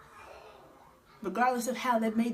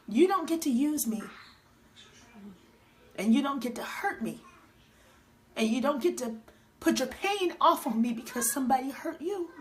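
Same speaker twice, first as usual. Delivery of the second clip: retold stand against an ex, higher pitched and emphatic; firm confrontation; 3 semitones higher than usual. Woman retelling how she told the man who hurt her, firm confrontation. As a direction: put your foot down, spacing out each statement.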